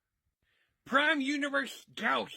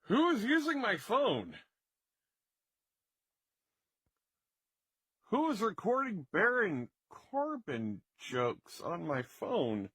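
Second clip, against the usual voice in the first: angry roommate, lightly voiced; 4 semitones lower than usual. Owner discovers the recording.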